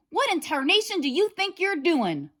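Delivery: high, loud scolding yell; angry farmer. A narrator's reading. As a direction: bellow at the caught wolf.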